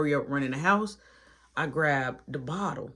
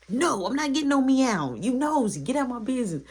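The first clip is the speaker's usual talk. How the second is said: annoyed retort; 7 semitones higher than usual. Retold or imitated. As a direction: tell him off, irritated. Snapping at nosy friend.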